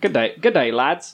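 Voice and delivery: trying out an Australian greeting; Australian accent. Shown as a demonstration. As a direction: test a broad Australian accent. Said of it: "can you do a K-, uh, Australian accent".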